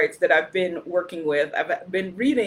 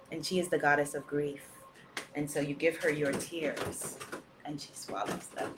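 much softer, fading to a breath; hushed; 7 semitones lower, 13 dB quieter than usual. Real, not acted. Leader speaking softly of the goddess of grief. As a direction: let the voice sink away.

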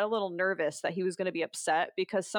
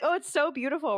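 mock gushing; 7 semitones higher, faster than usual. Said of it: "They're like".

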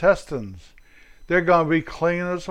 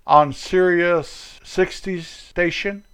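usual speaking voice

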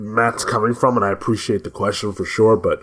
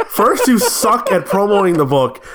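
laughing, high-pitched exasperation; exasperated scolding; 9 semitones higher than usual. Real, not acted. Co-host scolding host over promotion, amused exasperation. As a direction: tell them off while cracking up.

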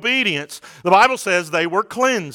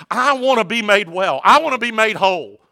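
raised, higher plea; weary world pleading, voiced with a preacher's force; imitated cry for help, pushed higher; 5 semitones higher than usual. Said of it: "a world that is crying out".

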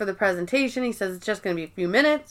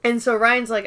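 ordinary talk, nothing marked

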